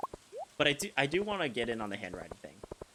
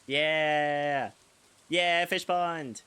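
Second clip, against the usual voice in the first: loud, high whoops of joy; excited cheer; 5 semitones higher, 8 dB louder, much slower than usual. Real, not acted. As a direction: cheer the fish pond like you just won. Cheering for the new fish pond.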